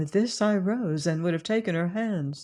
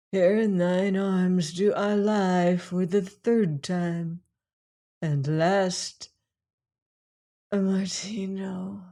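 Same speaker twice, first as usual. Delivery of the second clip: sighing; slower than usual. A narrator's reading. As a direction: breathe it out on a sigh. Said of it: "she sighed".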